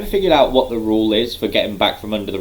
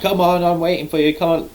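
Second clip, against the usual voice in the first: high-pitched goading imitation; mock taunt; 9 semitones higher than usual. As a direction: goad an opponent in a put-on high voice.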